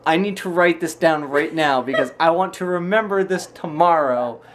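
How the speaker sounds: ordinary talk, nothing marked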